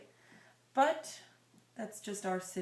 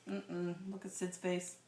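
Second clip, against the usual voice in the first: ordinary delivery, nothing marked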